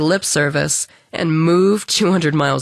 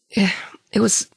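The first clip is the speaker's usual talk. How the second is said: breathy hushed whisper; 3 semitones higher than usual. Acted. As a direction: drop to a whisper, hesitant. Hesitant before grim word.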